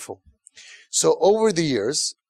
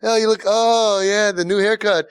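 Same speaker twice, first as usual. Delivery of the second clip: higher, louder gushing with forced praise; fake enthusiasm; 5 semitones higher, 4 dB louder than usual. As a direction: gush over the haircut like a parent faking approval. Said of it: "the fake smile".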